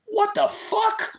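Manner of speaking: high, slow disbelief; stunned what the; shocked outburst. Real, not acted. Reaction to hang-up click.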